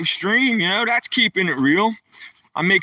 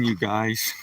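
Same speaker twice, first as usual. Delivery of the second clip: soft unvoiced whisper; 9 semitones lower, 7 dB quieter than usual. Real, not acted. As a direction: lean in and whisper it. Secretive aside to viewers.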